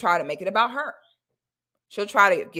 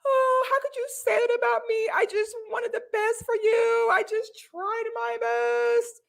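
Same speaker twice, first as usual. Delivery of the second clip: hammed-up wounded mother, pitched way up; fake crying mother; 14 semitones higher than usual. Mother denying abuse to her child, high and tearful. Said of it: "she'll try to cry and get emotional".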